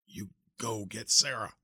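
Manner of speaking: slow, strained weak repeat; weak pleading. Acted. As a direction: repeat it slowly, fading. Dying uncle repeats, weaker.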